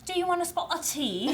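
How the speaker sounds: hammed-up posh British host; mock British accent. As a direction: toss off a jokey British tea offer. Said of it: "With that accent, give it to us".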